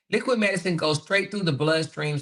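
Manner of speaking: usual speaking voice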